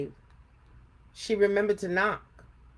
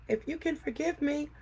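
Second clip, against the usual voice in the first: put-on little girl pleading; 6 semitones higher than usual. A narrator's reading. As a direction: promise sweetly to make amends. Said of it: "cried Afrotina".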